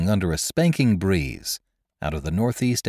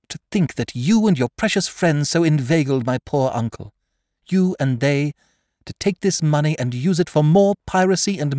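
higher, louder indignant outburst; crying out in anger; 9 semitones higher, 5 dB louder than usual. Acted. The girl accuses the captain of cheating her uncle, crying out. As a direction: let the anger break loose on YOU. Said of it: "she cried out".

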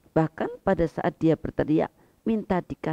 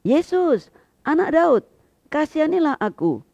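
high, loud desperate plea; a beggar's loud, high cry for mercy; 11 semitones higher, 6 dB louder than usual. A narrator's reading. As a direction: call out to Jesus across the crowd. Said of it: "mulailah ia berseru".

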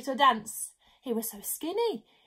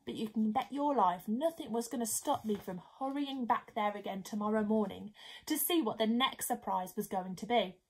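usual speaking voice

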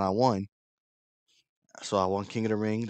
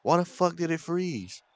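high, exasperated complaint; real frustration, pitched up high; so mad; 9 semitones higher than usual. Real, not acted. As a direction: whine in anger at a frozen laptop. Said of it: "I'm so fucking mad".

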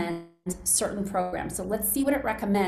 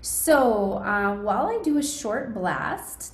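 usual speaking voice

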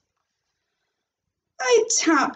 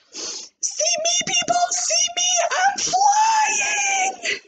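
very high, cried out as she zooms; little girl zooming around the room with arms out, ecstatic; flying play. Acted. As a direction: shout it like a kid pretending to fly. Zooming round room like plane.

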